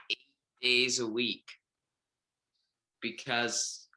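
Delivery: ordinary delivery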